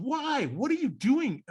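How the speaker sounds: high, incredulous questions; acted mental protest; baffled questioning. Voices the baffled brain.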